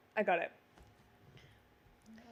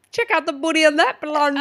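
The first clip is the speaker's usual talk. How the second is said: louder, higher quoted film line; movie quote; 5 semitones higher, 11 dB louder than usual. Acted. Player reveals the movie quote to friend, big quote voice. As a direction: deliver it big, like the character.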